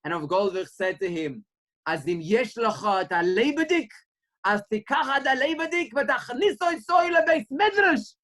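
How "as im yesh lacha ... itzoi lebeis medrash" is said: a lightly put-on rabbi rising higher and louder; voiced rabbi. Rabbi urges the boy to bring his fire, rising fervor.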